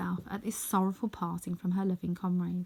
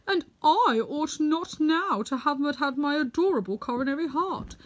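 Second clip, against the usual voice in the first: higher, louder Tin Woodman, fond and earnest; 7 semitones higher, 5 dB louder than usual. Acted. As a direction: speak as the Tin Woodman, stretching out his fondness.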